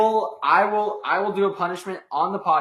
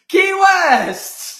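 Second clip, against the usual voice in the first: very high, loud shriek of a call; excited shout; 5 dB louder than usual. Real, not acted. Kid shouting his favourite Florida place, excited shout. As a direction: scream it out like a cheer.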